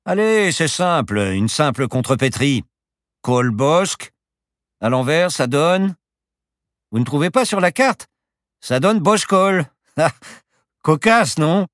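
high, loud, amused riddle-solver; excited professor showing off a puzzle; gleeful pilot. Acted. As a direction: revel in your own wordplay, voice well up. Pilot delights in his wordplay.

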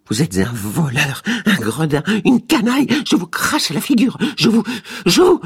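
high, loud enraged shriek building to breaking; furious scream. A narrator's reading. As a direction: spit the insults in fury. (hurlant de colère, de plus en plus aigu)

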